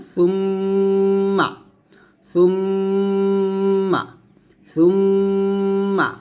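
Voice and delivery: সুম্মা with the doubled m held long and humming through the nose; nasal ghunnah. Shown as a demonstration. Teacher drills the required ghunnah on সুম্মা, held nasal hum. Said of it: "এখানে গুণনা করতে হবে".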